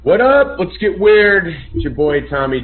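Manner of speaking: loud, high, pumped greeting; hyped show intro. Real, not acted. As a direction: kick off the stream with energy.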